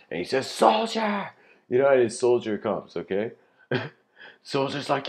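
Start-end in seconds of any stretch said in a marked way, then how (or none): 0.5-1.3 high, loud general's summons; calling out. Acted. Second general to his soldier, calling out. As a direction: shout for your soldier to come.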